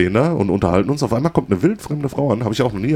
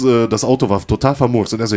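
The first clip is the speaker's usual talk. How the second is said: ordinary talk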